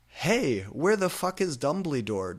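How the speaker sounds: loud, high demanding yell; angry shout. Acted. Draco yelling at the substitute teacher, angry. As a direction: demand it angrily. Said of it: "Draco shouted angrily".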